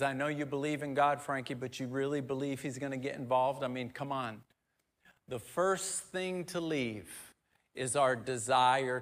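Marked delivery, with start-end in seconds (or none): 0.0-4.4 quick, hushed sneer; taunting enemy. Retold or imitated. The enemy needling Frankie, soft and fast. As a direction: whisper doubt fast and mocking.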